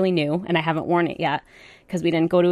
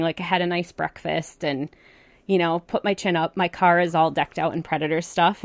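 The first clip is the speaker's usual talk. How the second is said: ordinary talk, nothing marked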